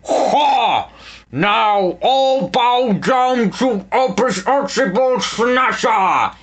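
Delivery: slow lisping gloating shout; lisping villain. A narrator's reading. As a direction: gloat with a lisp. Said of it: "he lisped".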